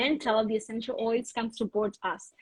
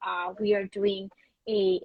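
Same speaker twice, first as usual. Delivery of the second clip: ordinary talk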